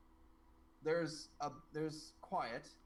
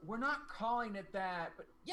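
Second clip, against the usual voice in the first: put-on little girl; 7 semitones higher, 6 dB louder than usual. Little girl correcting the name, then thrilled. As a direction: object firmly, then burst into a high cheer. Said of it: "And she goes".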